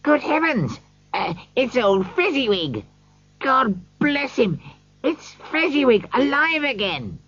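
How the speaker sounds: excited. A narrator's reading. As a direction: burst out with delight at seeing an old friend. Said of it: "Scrooge cried excitedly".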